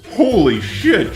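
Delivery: ordinary talk, nothing marked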